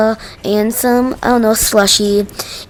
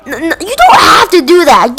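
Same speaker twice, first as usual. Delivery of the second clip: alarmed shout; 5 semitones higher than usual. Retold or imitated. Kid begs Karen to stop.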